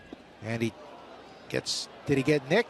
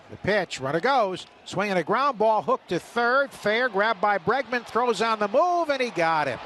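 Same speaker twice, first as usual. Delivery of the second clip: excited play-by-play; 7 semitones higher than usual. Real, not acted. Radio announcer to listeners, calling the play with rising excitement. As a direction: call the ground ball and throw live, building.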